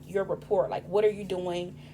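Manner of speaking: normal talking voice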